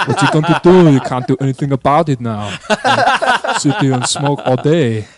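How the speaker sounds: heavy Austrian-accented movie-star impression, half laughing; Arnold Schwarzenegger. Telling how Arnie moved his office into a tent, Schwarzenegger impression. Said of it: "That's a good impression".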